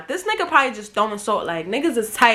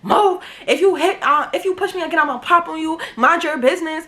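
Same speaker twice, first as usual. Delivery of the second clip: higher-pitched retold angry warning; mock quarrel; 5 semitones higher than usual. Friend lashing out at the one helping her, retold angry. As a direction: imitate a friend picking a fight. Friend acted as trying to fight.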